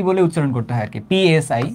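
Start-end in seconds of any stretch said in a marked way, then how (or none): 1.1-1.6 পিএসআই as separate English letters P-S-I; name each letter in পিএসআই; spelled-out letters. Shown as a demonstration. Teacher spelling the symbol's name, পিএসআই letter by letter. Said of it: "উচ্চারণ করতে হয় এরকম".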